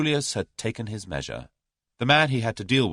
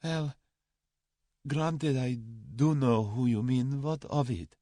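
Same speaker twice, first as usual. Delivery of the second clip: sullen; 7 semitones higher, slower than usual. A narrator's reading. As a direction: concede grudgingly, dragging it out. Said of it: "he said sullenly".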